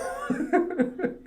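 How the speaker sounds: airy giggle; quick breathy chuckle; breathy high laugh. Real, not acted. Laughs at own joke.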